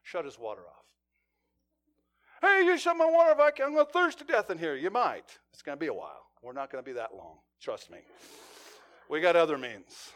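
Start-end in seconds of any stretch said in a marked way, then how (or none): 2.4-4.8 high, loud, hammed-up outrage, rushing the end; indignant complaint. Barricaded man protesting to the negotiator, in loud mock outrage. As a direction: play the trapped man griping, bigger than life.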